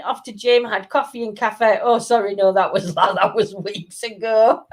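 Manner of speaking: normal talking voice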